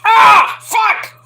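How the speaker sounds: sudden high shout of pain; mock shout of pain; pained yell. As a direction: yelp like your hand got bitten. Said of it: "starts, like, like".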